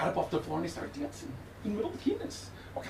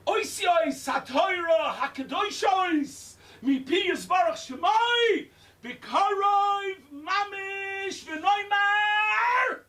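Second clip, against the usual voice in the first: raised, drawn-out chanted finale; climactic closing blessing; 12 semitones higher, 3 dB louder, much slower than usual. Acted. Rabbi closing the shiur with a raised blessing. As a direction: build the blessing to a loud close.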